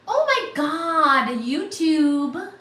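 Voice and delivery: realising something odd; real startled dismay; surprised. Real, not acted. Sees currency conversion.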